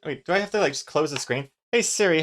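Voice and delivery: ordinary talk, nothing marked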